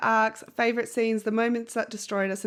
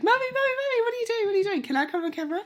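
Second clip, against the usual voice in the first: put-on kids' voice; 13 semitones higher, much faster than usual. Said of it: "they'll probably be like".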